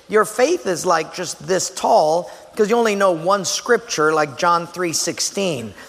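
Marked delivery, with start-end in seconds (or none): none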